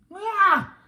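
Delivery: startled shriek, very high; loud high scream. A narrator's reading. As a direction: shriek in fright. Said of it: "cried Annie".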